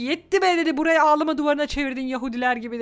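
loud, quick scolding from a grandmother done with the crying; fed-up grandma. Retold or imitated. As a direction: snap like an exasperated grandma who has had enough. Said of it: "dedi ki".

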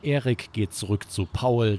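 plain reading voice

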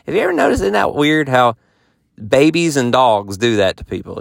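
ordinary talk

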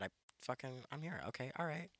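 ordinary talk, nothing marked

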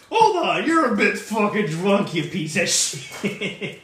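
high, loud, then slowing tough-guy mimicry; mock tough voice. Friend mocking the Minotaur's switch, high and loud. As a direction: ham up the angry Minotaur.

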